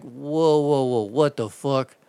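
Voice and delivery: slow, drawn-out baffled protest; slowed-down retold disbelief; taken aback. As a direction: act out mild shock at being yelled at. Acted startled reply to angry caller.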